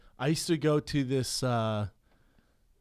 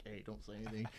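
much softer hushed aside; 5 semitones lower, 17 dB quieter, faster than usual. Retold or imitated. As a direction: lean in and keep it low. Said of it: "I'd lean over to Brian and be like".

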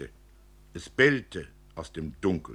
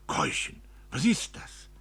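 plain reading voice

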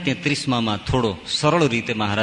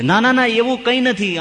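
raised pitch, quick to deny it; reassuring protest, pitched up; emphatic denial; 9 semitones higher, 4 dB louder than usual. Retold or imitated. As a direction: wave the worry away with insistent, higher-pitched no's. Old devotee denies the monks' guess.

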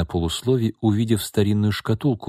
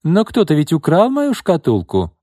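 imperious lady; 9 semitones higher, 6 dB louder than usual. Acted. Izolda insists on theft.

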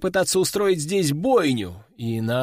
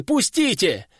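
shouting; 4 semitones higher than usual. A narrator's reading. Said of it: "закричал тот".

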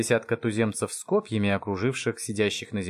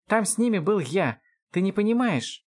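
high-pitched defensive elf; indignant; 10 semitones higher than usual. Acted. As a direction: argue back in exasperation. Эльф спорит, возмущён. (возмущённо, высоким голосом)

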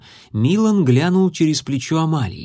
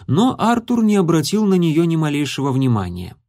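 ordinary delivery

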